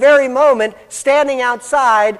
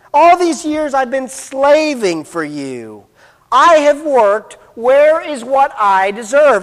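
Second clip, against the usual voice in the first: slow, higher, emphatic demand; resentful slave attitude; 4 semitones higher, 5 dB louder, slower than usual. Acted. Older son demands his due from his father, resentful. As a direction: hammer out each word as an entitled grievance. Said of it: "demonstrates the attitude of a slave".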